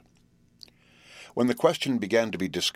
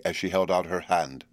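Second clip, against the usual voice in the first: ordinary delivery, nothing marked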